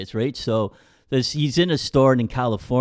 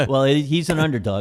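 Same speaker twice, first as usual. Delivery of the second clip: ordinary talk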